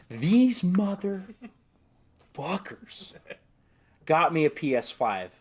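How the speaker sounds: drawn out high and amazed; slow high gasp of disbelief; overwhelmed. Real, not acted. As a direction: stretch it out, floored by the gift. Overwhelmed opening the gift.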